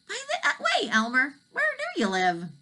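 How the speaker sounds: very high dragon asking; curious dragon. Acted. Dragon asks Elmer where he lives, high.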